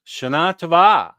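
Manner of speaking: "Shana Tova" as sha-NA to-VA, falling on the end; stress on second half. Shown as a demonstration. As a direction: stress the last syllable of each word in "Shana Tova". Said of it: "In Hebrew, it's the second half".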